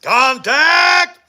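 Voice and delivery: loud, high, sharp call; shouted call. Acted. Morning show host acting out a cry of contact, shouted.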